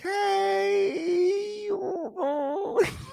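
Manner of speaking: squealing cackle; high shrieking laugh. Real, not acted. Howling with laughter on stream.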